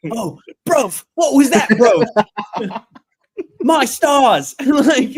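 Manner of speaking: high, loud mock British accent with laughs. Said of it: "You gotta do the accent".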